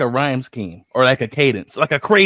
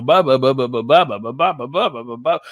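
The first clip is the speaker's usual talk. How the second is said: dismissive "ba-ba-ba" rhythm, imitating a rapper; mock rap sounds; 3 semitones lower, 3 dB louder than usual. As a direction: mimic a rap flow in empty syllables. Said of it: "all he did was go".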